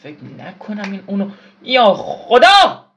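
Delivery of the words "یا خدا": high, loud, slow cry of alarm; panicked cry. Real, not acted. Gamer cries out in panic as he falls.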